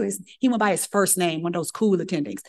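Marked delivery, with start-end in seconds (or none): none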